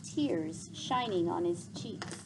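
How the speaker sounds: soft, fading storytelling; story narration. Acted. Teacher reading the farewell scene aloud, softening narration. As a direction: let the scene settle quietly. Teacher reads novel to class.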